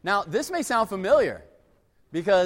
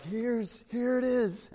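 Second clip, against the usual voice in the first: put-on high, small child voice; hurt child; 6 semitones higher, slower than usual. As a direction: mimic a kid showing his boo-boo. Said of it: "And he's like".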